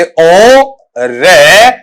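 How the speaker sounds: ओ रे split slowly into two syllables, ending on e; drawn-out teaching demo. Shown as a demonstration. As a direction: stretch ओ रे syllable by syllable. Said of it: "last में ए का sound देगा".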